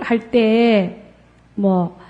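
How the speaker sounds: ordinary talk, nothing marked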